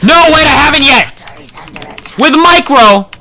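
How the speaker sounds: high, loud burst of sudden hope; frantic gamer comeback cry; excited shouting. Real, not acted. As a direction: yell it urgently, seizing a last chance. Sudden hope of saving the game.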